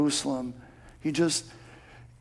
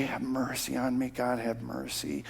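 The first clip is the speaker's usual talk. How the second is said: quiet prayer; 6 semitones lower, 12 dB quieter than usual. Retold or imitated. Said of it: "And I just say".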